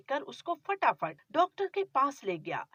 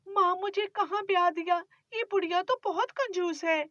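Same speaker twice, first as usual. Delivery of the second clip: put-on higher, pleading young wife; young bride; 8 semitones higher than usual. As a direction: defend yourself meekly to your mother-in-law. Bride complains to mother.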